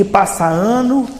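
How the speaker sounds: "passa ano" subindo no fim; entonação ascendente. Shown as a demonstration. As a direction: deixar "ano" suspenso, em tom crescente. Lista repetida dos anos passando. ("passa ano" com entonação ascendente)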